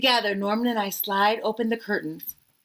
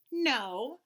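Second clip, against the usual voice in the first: usual speaking voice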